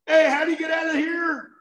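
high, hopeful call for help. Retold or imitated. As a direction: shout the question toward the light. Calling out of the cave.

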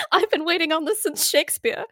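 laughing. Pretend. Laughing as English major.

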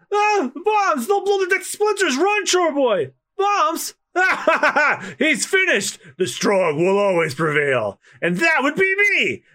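high, frantic crew voice; panicked high voice. Acted. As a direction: shriek it out in panic. Panicked crew then Krieg.

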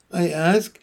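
usual speaking voice

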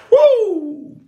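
high, loud preacher whoop. Real, not acted. Preacher whooping to fire up the congregation, high and loud. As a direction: let out a high excited woo.

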